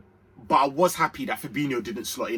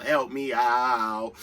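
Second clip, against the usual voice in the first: help me out stretched very slowly and lower; drawn-out plea; 3 semitones lower, much slower than usual. Real, not acted. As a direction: drag it out, begging the viewers. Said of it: "So come on".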